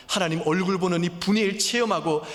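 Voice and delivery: 높고 크게 외치듯 설교; 목소리를 높여 절박하게 호소하기; impassioned preaching. Acted. Preaching at full force. (열정적으로 외치는 설교)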